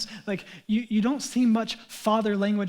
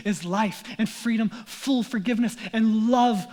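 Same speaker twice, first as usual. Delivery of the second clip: urgent, raised preacher's voice; impassioned preaching; 3 semitones higher, 5 dB louder than usual. Real, not acted. Preacher urging the church to grow their faith, impassioned. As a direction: drive it forward with mounting force.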